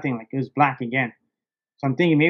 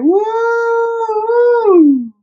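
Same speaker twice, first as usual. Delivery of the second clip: very high, loud victory whoop, then slower; ecstatic whoop; 9 semitones higher, 6 dB louder, much slower than usual. Retold or imitated. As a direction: crow with triumph like a rooster. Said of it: "I was so excited right when I got to the boat".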